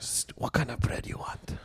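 unvoiced slow whisper. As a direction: whisper the sandwich order slyly. Imitating sandwich worker whispering.

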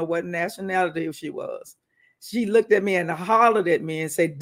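ordinary talk, nothing marked

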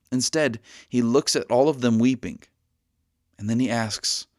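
usual speaking voice

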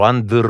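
"Andr" with the R rolled long on the tongue tip; trilled R. Shown as a demonstration. Actor demonstrating R vibrations, strongly trilled R. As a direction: roll out the R in "Andr" firmly. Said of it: "trebuie să fie patru".

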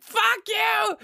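high-pitched hammed-up yell; mock furious shout. Son screams at parents in imagined bad relationship, mock furious. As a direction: yell up high in pretend fury.

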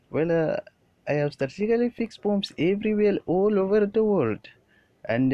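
put-on high, loud, cheery Indian-accented greetings; Patip's Indian accent. Villain Patip greeting everyone on screen, high loud Indian accent. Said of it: "we just hear Patip like".

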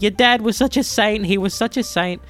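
teasing impression of a mother laying on guilt; mother guilt-tripping. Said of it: "and she's like".

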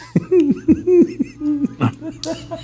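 high, drawn-out laugh. Real, not acted. Host cracks up at his own topic, high laughter. Cracks up after saying insecurities.

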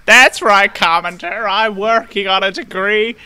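put-on high, loud old-timer voice; creaky old prospector caricature; hammed-up old man in an American accent; elderly man. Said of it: "Now you just sound like an elderly man".

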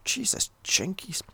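breathy whispered exasperation; a whispered, weary curse; exasperated whisper. Real, not acted. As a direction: whisper it under your breath, fed up. Exasperated aside to himself.

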